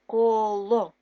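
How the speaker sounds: put-on колo read out clearly for children; reading word aloud. Shown as a demonstration. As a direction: say коло slowly, each sound clear. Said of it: "syllable reading demo".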